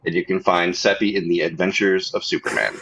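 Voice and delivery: ordinary talk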